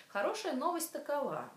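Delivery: normal talking voice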